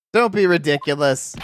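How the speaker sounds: usual speaking voice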